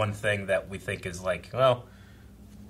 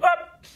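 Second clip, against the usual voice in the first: very high, loud short "up"; cut-off comic yelp; imitated yelp; 7 dB louder than usual. Mimicking the man thrown into space, short high yelp.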